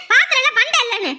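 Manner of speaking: ordinary talk, nothing marked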